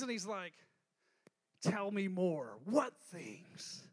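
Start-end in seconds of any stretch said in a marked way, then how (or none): 1.6-3.8 slow, lower, coy; teasing innocence. Retold or imitated. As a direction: draw it out, pretending not to know. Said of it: "Jesus has a sense of humor here".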